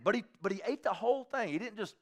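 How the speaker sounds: ordinary delivery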